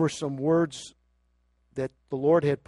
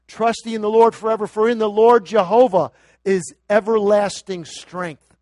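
fast, loud scripture proclaimed; louder, higher, rushing proclamation; preaching; 6 semitones higher, 11 dB louder than usual. Real, not acted. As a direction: ring out the verse. Scripture read at full force.

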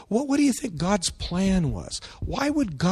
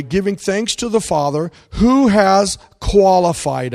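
emphatic, loud pulpit reading; scripture reading; 2 semitones higher, 7 dB louder than usual. Real, not acted. Pastor reading Colossians to the church, emphatic. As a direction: read the verse out as a proclamation.

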